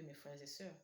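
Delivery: ordinary talk, nothing marked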